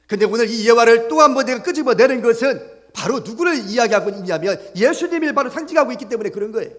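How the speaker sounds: high, loud, driving sermon delivery; pressing the point home to the congregation; full-force preaching. Real, not acted. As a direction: preach it out with rising urgency.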